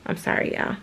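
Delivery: choked, breathy whisper to the viewers; apologising to viewers, choked whisper through tears; tearful apology. Real, not acted. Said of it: "I don't know why I'm crying".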